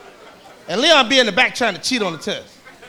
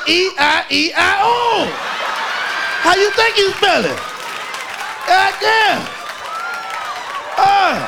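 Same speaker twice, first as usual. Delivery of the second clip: answering Leon's spelling question, a mock yell; exasperated shout; 9 semitones higher, much slower than usual. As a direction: yell the letters out at him. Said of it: "He said".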